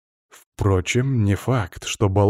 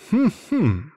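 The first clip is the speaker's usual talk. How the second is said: high, pointed "hm-hm"; attention-getting throat clear; 8 semitones higher than usual. A narrator's reading. Eksara drawing attention to himself, pointed hum. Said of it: "обратил на себя внимание Эксара".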